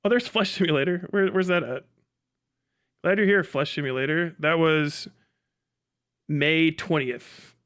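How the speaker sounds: normal talking voice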